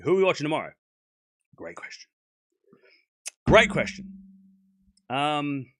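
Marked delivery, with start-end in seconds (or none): 1.5-2.1 much lower and softer, barely there; quiet mumble. Pretend. Streamer musing over a viewer's question, quiet mumble. 3.5-4.0 higher and louder, emphatic; bright emphatic. Pretend. Streamer praising a viewer's question, loud and emphatic.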